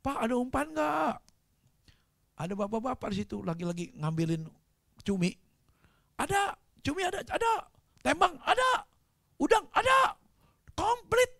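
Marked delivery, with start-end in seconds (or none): 0.1-1.2 acted hail, pitched high; retold call. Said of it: "saya teriak". 6.2-11.3 very high, loud acted hollers; high-pitched hawker-like shouts; calling back. Fishermen calling back across water.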